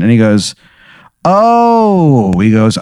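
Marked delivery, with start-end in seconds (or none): 1.2-2.4 surprised oh. Acted. Doctor recognizing the caller.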